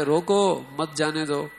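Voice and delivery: "روکو" drawn out alone, then "مت جانے دو"; pause after روکو. Shown as a demonstration. Guard misreading a written note, pause after روکو. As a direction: slowly, stop on "روکو", then "مت جانے دو" together. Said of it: "وہ اسے پڑھے".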